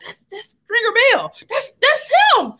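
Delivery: high-pitched starstruck gasp of recognition. Retold or imitated. Makeup artist spots the actor backstage, starstruck. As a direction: excitedly point out a star.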